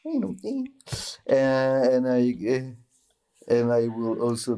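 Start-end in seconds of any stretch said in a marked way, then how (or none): 0.1-0.7 Wire-style slang. Retold or imitated. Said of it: "I will say, um, stuff like".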